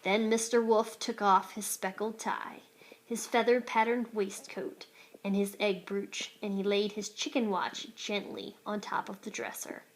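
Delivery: ordinary delivery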